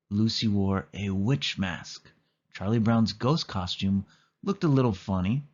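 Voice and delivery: plain reading voice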